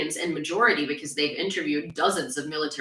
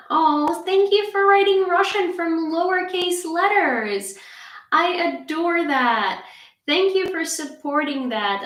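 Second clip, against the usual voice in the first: high, slow mock-sweet thank-you; pitched-up fake gratitude; sarcastic thanks; 10 semitones higher, 3 dB louder than usual. Real, not acted. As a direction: overdo the gratitude to mock the troll.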